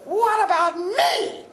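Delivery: very high, slow squeaky puppet voice; high offended little puppet protest; Lamb Chop puppet voice. Retold or imitated. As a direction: squeal it out as an indignant sock puppet. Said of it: "suddenly you hear".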